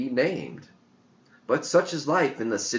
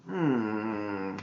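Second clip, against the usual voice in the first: ordinary delivery, nothing marked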